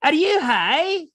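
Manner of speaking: very high, slow squeal of disbelief; retelling her reply in a slow high squeal; mock incredulous girl. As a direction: stretch it out, high and mocking. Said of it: "That's what she said".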